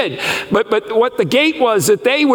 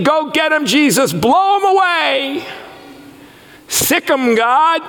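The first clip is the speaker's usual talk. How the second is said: zealot crowd; 8 semitones higher than usual. Retold or imitated. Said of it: "they're saying".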